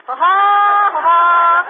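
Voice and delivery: staged ha ha ha; mock triumphant laugh. Aunt gloating at Costello with a staged laugh. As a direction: cackle pleased with herself.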